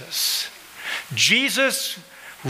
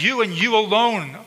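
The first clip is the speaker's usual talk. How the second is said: both words You and you stressed high; emphatic YOU; 6 semitones higher than usual. Real, not acted. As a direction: hammer You and you alone. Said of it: "it's emphatic".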